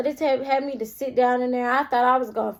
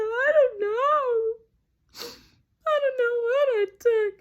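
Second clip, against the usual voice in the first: mock crying; 12 semitones higher than usual. Answering the manager's questions, acted high-pitched crying. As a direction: whine it tearfully, hammed up.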